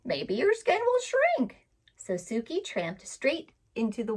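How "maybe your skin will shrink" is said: high, teasing parrot voice; a saucy bird giggling advice; laughing parrot. Acted. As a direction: parrot teasing Suki to soak like the crocodile. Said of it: "laughed the parrot".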